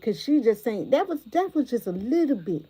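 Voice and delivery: usual speaking voice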